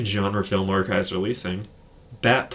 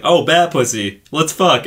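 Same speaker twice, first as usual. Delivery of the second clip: mock eager couple; 5 semitones higher, 6 dB louder than usual. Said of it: "it's just like".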